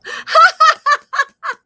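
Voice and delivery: a high burst of real laughter; loud laugh. Real, not acted. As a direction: let a big laugh burst out. Laughing at her messy studio.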